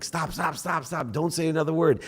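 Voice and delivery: high, urgent repeated stops; inner voice pleading. Retold or imitated. Holding himself back in an argument with his wife, high urgent pleading. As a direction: beg yourself to shut up, pitched high. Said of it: "This is my own head".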